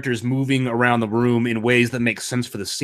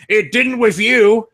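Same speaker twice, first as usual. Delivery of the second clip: slow, much higher and louder mock jab; snarky heckler; 11 semitones higher, 5 dB louder, slower than usual. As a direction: throw it back at him like a heckler. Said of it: "some random guy in the audience is like".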